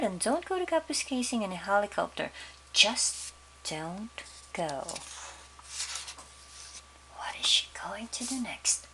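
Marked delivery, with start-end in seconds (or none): 2.7-4.7 slow firm. Acted. Narrator sums up father's rule. 7.2-8.9 soft whispered question. Real, not acted. Narrator teases listeners.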